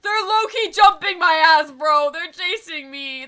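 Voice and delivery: high, loud panicked complaint; frantic as enemies pile on; being chased. Real, not acted. Husks chasing in game.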